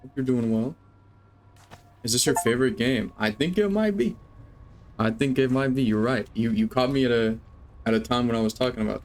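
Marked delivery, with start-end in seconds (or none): 3.2-4.2 playful high answer. Real, not acted. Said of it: "repeats answer to chat".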